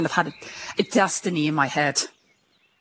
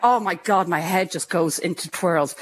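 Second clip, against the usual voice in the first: ordinary talk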